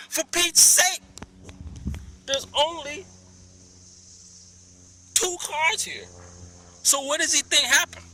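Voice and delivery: high, loud exasperated rant. Real, not acted. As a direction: vent in frustration.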